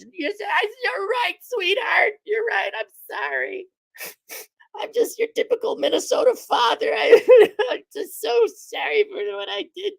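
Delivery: hammed-up sobbing apology; mock sobbing. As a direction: Minnesota father wails sorry to daughter, hammed-up crying.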